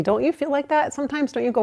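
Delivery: normal talking voice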